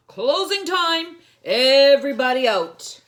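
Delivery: high, loud closing-time shout; loud call. Acted. Manager shouting to customers at closing, loud call. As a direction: call out to the whole laundromat. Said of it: "the manager of the laundromat called".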